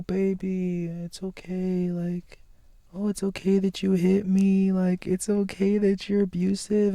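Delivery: put-on doting baby mama. Baby mama soothing abusive partner, soft mock coo. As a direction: coo it sweetly, excusing him. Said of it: "you're like".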